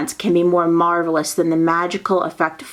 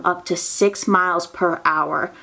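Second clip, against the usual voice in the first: ordinary delivery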